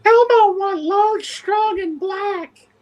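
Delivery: put-on high, childlike puppet voice; Elmo. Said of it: "using your best Elmo voice".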